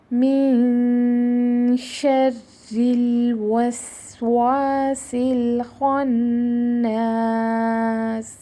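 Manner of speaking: slow, drawn-out tajweed recitation; Quranic recitation. Shown as a demonstration. Teacher recites the verse for her students, slow and chanted. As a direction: recite each word long and measured. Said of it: "چلیں اپنی line کو ہم پڑھتے ہیں".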